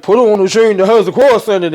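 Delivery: son repeating dad's order back with mild mockery; mocking repeat. Son echoing his father's order, mildly mocking. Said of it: "And then Terry like".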